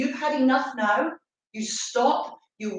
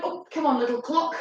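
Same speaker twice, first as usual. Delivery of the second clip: ordinary delivery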